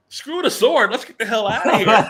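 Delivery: high, loud, mock-panicked refusal; exasperated heroine. Heroine to her squire in the woods, loud high exasperated refusal. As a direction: act out her urgent outburst, pitch leaping up. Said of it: "And she goes".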